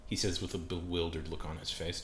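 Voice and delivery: ordinary delivery, nothing marked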